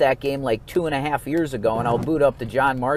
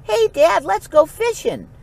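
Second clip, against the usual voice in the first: very high put-on kid voice; little Jack; 4 dB louder than usual. Little boy asking dad to go fishing, high-pitched imitation. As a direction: pipe up like a small boy pestering Dad. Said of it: "little Jack comes up to Dad".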